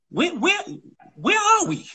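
high-pitched baffled cry; mock bewilderment. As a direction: play up the confusion, pitched high. Acting child watching TV shows.

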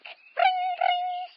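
very high loud ring ring; high loud imitated ring; phone ring. Phone ringing.

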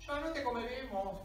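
slightly put-on, high-pitched chief voice; lightly voiced chief. Chief sparing his captive, lightly voiced chief. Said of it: "El cacique se me acercó y me dice".

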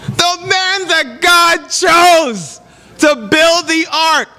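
put-on preacher to congregation, shocked that Noah got drunk; shocked outburst. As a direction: exclaim in mock outrage, stretching the words.

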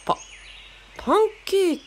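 high, startled repeat of pancake; surprised. Acted. Kaoru surprised by Margarita's pancake comparison, startled stammer. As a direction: caught off guard, stumbling over the word.

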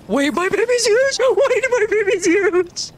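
high, rising wail of disbelief; shocked exclamation. Real, not acted. Player seeing the game baby turned giant, shocked wail. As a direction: cry out high, stretching the repeat.

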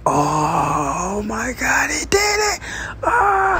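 high, crying-out crowd reaction; excited crowd. Retold or imitated. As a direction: scream like an amazed crowd. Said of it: "Everybody is like".

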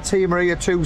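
ordinary delivery

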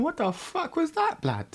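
high, startled inner voice; shocked. Retold or imitated. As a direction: sound baffled at the noise. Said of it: "I'm thinking".

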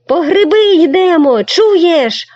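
high loud call. Acted. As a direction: shout the plan at him. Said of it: "гукнув Сашко Циган". (гучний високий поклик)

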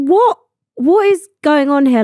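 retold disbelief, starting high; incredulous. Passenger wondering why boarding never came, incredulous. Fed up waiting at the gate.

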